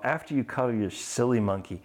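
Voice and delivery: ordinary delivery, nothing marked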